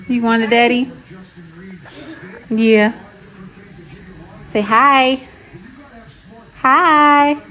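high, loud mommy voice. Real, not acted. Mom coaxing baby to say hi, high baby talk.

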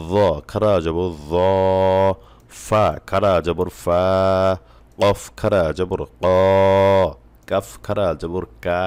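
slow, measured letter drill, last syllable such as "সা" or "হা" held long; name each letter, then stretch its sound, as in "লা"; drawn-out recitation. Shown as a demonstration. Teacher reading Qaida aloud for learners, slow drawn-out recitation. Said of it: "এক আলিফ টানিয়া পড়িতে হয়".

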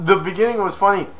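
usual speaking voice

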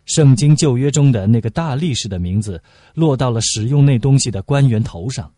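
usual speaking voice

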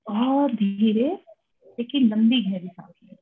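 unhurried meditation guide, long pauses between phrases; calm instructions with room to breathe; guided meditation. Real, not acted. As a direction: lead them gently into relaxation, phrase by phrase.

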